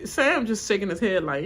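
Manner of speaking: ordinary talk, nothing marked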